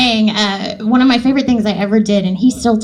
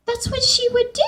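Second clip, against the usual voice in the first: small, high, softer voice; sheepish defence; 14 semitones higher, 5 dB quieter than usual. Retold or imitated. Actor justifying her ad-libs to the director, sheepish. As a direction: defend it meekly, pitched up and soft.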